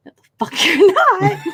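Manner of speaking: high-pitched, incredulous mock refusal; Rune's refusal. Fan acting out Rune guarding his horns, high mock outrage. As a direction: play up the outraged objection, voice jumping high. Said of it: "and Rune is like".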